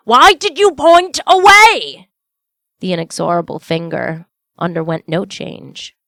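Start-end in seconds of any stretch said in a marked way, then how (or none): 0.1-2.1 much higher and louder, slow and urgent; exclaiming. A narrator's reading. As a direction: Scrooge desperate, pointing at his house. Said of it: "Scrooge exclaimed".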